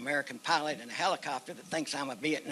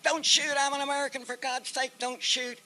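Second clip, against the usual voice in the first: high, urgent shouted plea; retold shout; 11 semitones higher, 5 dB louder than usual. Surrendering American to soldiers at the base, retold shout. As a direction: shout it high, begging them to hold fire. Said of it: "I jumped up and I shouted".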